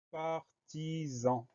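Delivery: "partisan" very slow and soft, each syllable drawn out. Shown as a demonstration. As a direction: stretch every syllable of "partisan" for dictation. Teacher sounds out new word.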